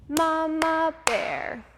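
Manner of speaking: a hint of a gentle mother bear, slow; Mama Bear voice. Shown as a demonstration. Teacher shows kids Mama Bear, lightly voiced and slow. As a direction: sing it out slow and kind. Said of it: "medium-pitched, medium-tempo, soft, gentle voice".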